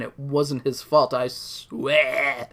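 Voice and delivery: bad Scottish accent, pleading. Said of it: "yelling in his Scottish accent".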